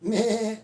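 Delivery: high bleating meh; goat bleat. Retold or imitated. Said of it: "It's funny watching her run around going".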